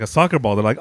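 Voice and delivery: normal talking voice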